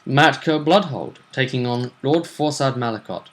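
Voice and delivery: ordinary delivery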